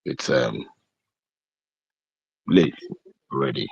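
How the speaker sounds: low-pitched, held-back late-night voice. Real, not acted. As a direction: keep your voice down, it's late. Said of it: "I have to lower my voice".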